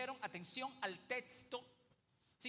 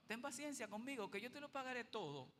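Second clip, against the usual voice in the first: soft quick plea; 8 dB quieter, faster than usual. Acted. Repeats the servant's plea.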